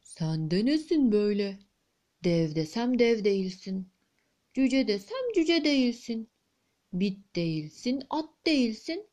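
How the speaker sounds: muffled, hoarse voice of a giant queen. Acted. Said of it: "boğuk bir sesle şöyle demiş".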